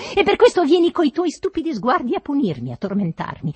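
high, loud tirade; furious accusation. A narrator's reading. Master accuses servant of tormenting him, furious. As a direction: hurl the accusation angrily.

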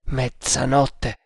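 slow, stunned murmur of one word; trembling murmur. A narrator's reading. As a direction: murmur it slowly, with a touch of dread. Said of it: "mormorò con voce tremante". (mormorio lento, lievemente tremante)

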